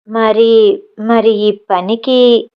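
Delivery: slow, halting question; groping for what to say, very slowly; fumbling for words. Acted. Aruna stuck for words asking the maid, slow and halting. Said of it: "మాటలు తడుముకుంటూ అడిగింది అరుణ".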